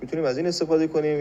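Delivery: usual speaking voice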